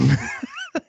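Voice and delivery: sudden shrill giggle burst; real squeal of laughter; high squealing laugh. Real, not acted. Laugh after joke about mom.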